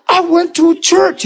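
very high, squealed news; retold excitement at the top of the voice; excited announcement. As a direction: act out bursting with news. Acts out coworker's excited news.

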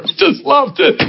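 ordinary delivery

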